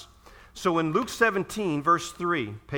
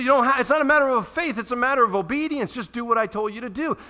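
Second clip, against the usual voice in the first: higher, louder, fast and insistent; forceful command; 8 semitones higher, 4 dB louder than usual. Acted. Preacher voicing Jesus correcting the disciples, insistently. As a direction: drive it home, no excuses.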